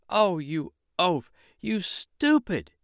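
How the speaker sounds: high, slow wife's voice, scolding; a wife scolding her oafish husband; high drawn-out mock shriek. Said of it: "shrieked his wife".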